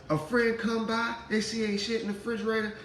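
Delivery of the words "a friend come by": ordinary delivery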